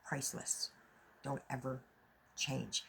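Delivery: breathy whisper, no voice. Shown as a demonstration. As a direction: whisper the word Priceless. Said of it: "priceless to me".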